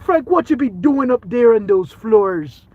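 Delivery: high, loud, slow mock Jamaican holler; Jamaican accent. Jamaican coworkers calling out to Frank, imitated Jamaican accent. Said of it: "They're like".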